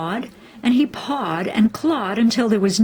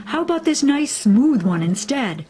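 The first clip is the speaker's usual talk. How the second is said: higher, louder big sister; Big Sarah; 7 semitones higher, 3 dB louder than usual. A narrator's reading. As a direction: coax her toward the smooth one. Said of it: "said Big Sarah".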